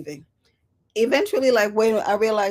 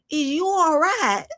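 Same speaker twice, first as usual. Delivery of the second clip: slow mock concern; 10 semitones higher, 8 dB louder, slower than usual. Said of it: "I'm like".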